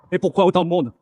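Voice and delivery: usual speaking voice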